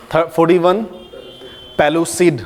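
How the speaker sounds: ordinary delivery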